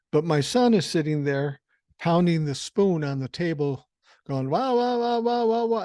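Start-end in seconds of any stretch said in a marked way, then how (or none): 4.5-5.8 high, sustained imitation of a noisy toddler; loud toddler babble. Son pounding his spoon on the table, high mock wailing. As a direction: mimic a kid wailing along to banging. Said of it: "he's kinda loud".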